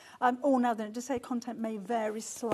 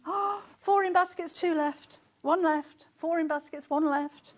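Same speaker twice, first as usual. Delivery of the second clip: higher and louder, rushing through the stock numbers; excited countdown; 5 semitones higher, 7 dB louder than usual. Real, not acted. Presenter calling out the last kits to viewers, excited. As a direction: call out each number as it drops.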